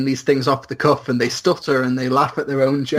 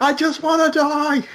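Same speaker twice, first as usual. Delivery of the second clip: high-pitched mock desperate wail; Meeseeks; 12 semitones higher than usual. Quoting a cartoon character who wants to die, high mock wail. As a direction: wail it in a far higher put-on cartoon voice. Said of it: "and Meeseeks is like".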